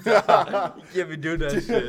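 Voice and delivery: laughing while talking. Real, not acted. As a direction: talk through laughter. Laughing at whisper.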